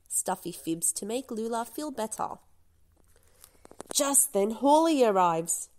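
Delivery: ordinary delivery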